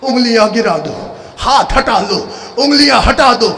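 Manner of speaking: chanted, high-pitched mourning oration; lamenting zakir reciting to mourners; majlis recital. Acted. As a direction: preach the tragedy at full force, wailing.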